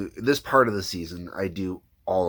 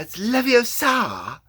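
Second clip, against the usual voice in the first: put-on much higher, slow, far-off girl's voice; Hermione impression; 5 dB louder, slower than usual. Shown as a demonstration. Showing viewers his Hermione warm-up, high and slow. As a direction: stretch it out primly, like Hermione. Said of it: "for Hermione, for example".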